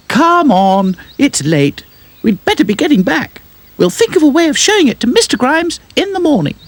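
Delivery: very high doll voice; Dotty's voice. A narrator's reading. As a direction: coax them like a kind doll. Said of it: "Dotty smiled".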